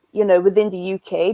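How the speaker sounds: usual speaking voice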